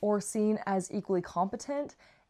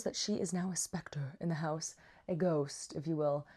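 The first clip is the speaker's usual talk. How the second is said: breathy ghost-story whisper; whispered ghost rumor; spooky whisper; 3 semitones lower, 5 dB quieter than usual. Pretend. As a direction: hush it like a campfire ghost tale.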